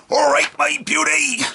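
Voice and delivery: put-on a loud Cornish pirate accent. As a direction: bellow it like an old Penwith Cornish fisherman. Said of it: "what we now think of as a pirate's accent".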